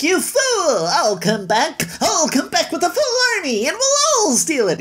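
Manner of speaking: hammed-up villain threat; ranting thief. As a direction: threaten to come back with an army. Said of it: "Lilliputian's like".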